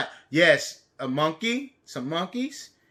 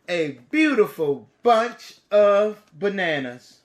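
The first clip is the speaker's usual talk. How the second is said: lively, bright storybook reading; reading to children; 3 semitones higher, 4 dB louder than usual. A narrator's reading. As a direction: read it big for little kids. Storybook narration.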